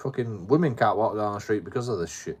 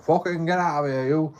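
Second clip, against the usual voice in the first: imitated higher-pitched yell; hostile order; 7 semitones higher, 4 dB louder than usual. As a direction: act out a man chasing someone off. Aggressor ordering him away.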